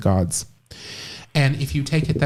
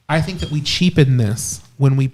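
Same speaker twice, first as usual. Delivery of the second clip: usual speaking voice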